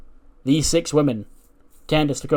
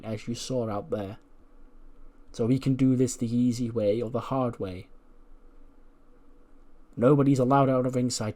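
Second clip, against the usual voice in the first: Candice in his face; 7 dB quieter than usual. Acted. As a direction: lay down terms softly, with menace. Gets in his face and goes.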